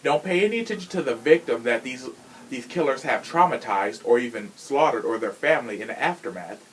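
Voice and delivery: usual speaking voice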